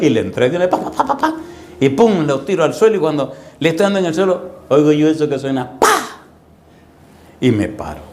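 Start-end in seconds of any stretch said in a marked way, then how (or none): 0.7-1.3 rapid high "pa-pa-pa" of blows landing; flurry of mimed punch sounds; imitated punches. 5.8-6.2 sudden very high "pa!"; high-pitched smack noise; imitated whack. Imitates the stick's crack.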